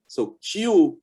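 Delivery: ordinary delivery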